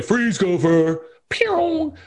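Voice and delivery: slow, high, with a gun noise; Caddyshack quote. Retold or imitated. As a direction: aim and say it slowly. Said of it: "I do enjoy".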